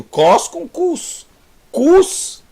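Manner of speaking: cos then cus, both pitched high and slow; contrasting Hebrew words. Shown as a demonstration. Teacher shows the mix-up between cos and cus, high and slow. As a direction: set cos against cus, stressing the vowel. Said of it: "é muito parecido".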